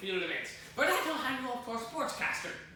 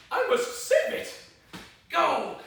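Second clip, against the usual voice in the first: urgent dash; 6 semitones higher, 7 dB louder, slower than usual. Acted. Dashes to save falling nut.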